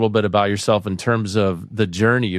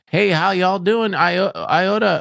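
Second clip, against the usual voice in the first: higher-pitched, badly faked Southern accent; 8 semitones higher, slower than usual. Trying to figure out iota, mock Southern accent. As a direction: try out a hammed-up Southern greeting. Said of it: "that again was [name] doing a Southern accent".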